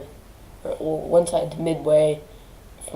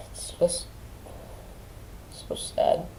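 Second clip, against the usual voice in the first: whisper; 9 dB quieter than usual. Real, not acted. Grief over grandmother.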